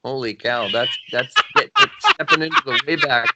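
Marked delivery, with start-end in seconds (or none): 1.6-1.7 high voice. Acted. Recalling an old memory. 1.8-3.3 pitched way up; high voice. Acted. Same phrase said high first.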